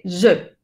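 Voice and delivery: je with a rounded schwa; French je. Shown as a demonstration. Teacher showing how je sounds in French. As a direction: say je slowly with lips rounded. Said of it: "se escribe J E, se dice je".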